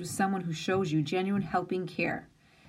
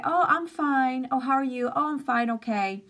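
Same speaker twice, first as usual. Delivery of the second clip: fake cheer; 5 semitones higher than usual. Pretending to be fine when asked, fake cheer. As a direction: put on a bright fake smile in the voice. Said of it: "So we just say".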